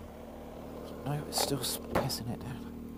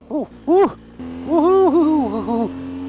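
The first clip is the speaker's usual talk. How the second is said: string of high, excited oohs over the bumps; high whooping oohs; 12 semitones higher, slower than usual. Real, not acted. Rider riding a rough gravel road, high excited oohs. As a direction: yelp ooh after ooh as the bike jolts. Bumpy descent on bike.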